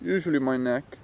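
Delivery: soft, unhurried meditation voice with long pauses; guided meditation. Real, not acted. Teacher talking listeners through relaxing the muscles, guided meditation. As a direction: guide them gently, leaving space after each phrase.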